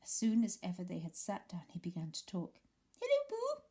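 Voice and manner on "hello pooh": very high, tiny excited squeak; squeaky Roo. Acted. Roo greeting Pooh at the party, high squeak. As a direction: squeak it out like a little joey. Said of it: "he squeaked".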